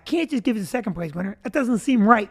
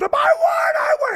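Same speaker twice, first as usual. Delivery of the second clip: very high, drawn-out mock squeal; shrieky, high imitation of a winner celebrating; imitated excited winner. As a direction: squeal it like an overjoyed girl winner. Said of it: "this girl said".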